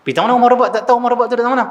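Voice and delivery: imitating an insistent kid, pitched high; high-pitched mock-incredulous child; arguing child. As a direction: press the point like a small child arguing. Retold child argues with father.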